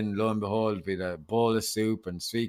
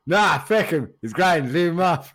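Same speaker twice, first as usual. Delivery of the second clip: loud, high, dismissive Irish brush-off; dismissive Irish mates; 9 semitones higher, 10 dB louder than usual. Retold or imitated. Friend mocking his mates' refusal to help, loud dismissive Irish. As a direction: wave him away like a careless mate. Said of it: "and Paddy were like".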